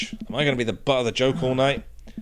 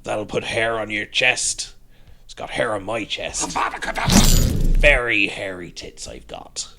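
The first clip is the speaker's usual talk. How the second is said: put-on pirate accent; 3 dB louder than usual. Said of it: "She's a pirate now".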